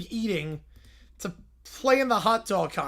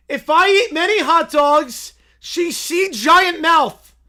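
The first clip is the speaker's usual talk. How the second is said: higher, louder, slow dim bear shout; 7 semitones higher, 9 dB louder, slower than usual. Acted. Little Brown Bear shouting about romance, slow and loud. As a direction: bellow it like a lovesick bear.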